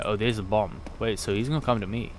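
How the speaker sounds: ordinary delivery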